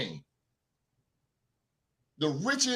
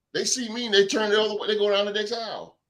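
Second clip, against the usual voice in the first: normal talking voice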